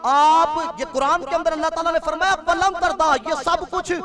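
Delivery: loud, high-pitched sermon delivery; full-force preaching. Real, not acted. Preacher challenging listeners about Saudi Arabia, full-force preaching. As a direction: drive each point home to the congregation.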